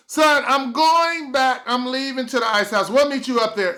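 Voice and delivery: lightly voiced mom giving up.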